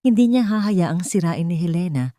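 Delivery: usual speaking voice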